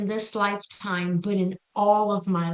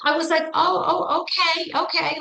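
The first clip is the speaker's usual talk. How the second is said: higher, nervous oh okay in quick agreement; scared; 8 semitones higher than usual. Retold or imitated. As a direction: squeak out a frightened yes. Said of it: "So I was terrified of her".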